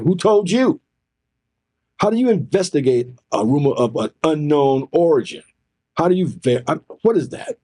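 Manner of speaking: high-pitched, incredulous challenge; retold disbelieving interrogation; indignant questioning. Retells challenging vague rumor accusation.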